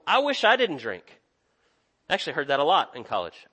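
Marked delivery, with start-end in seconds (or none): 0.1-1.2 higher-pitched wishing, falling away; lightly acted longing, pitched up; mock wistful. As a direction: imitate a student's wistful confession. Voicing regretful drinkers at college.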